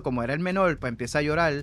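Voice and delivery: ordinary talk, nothing marked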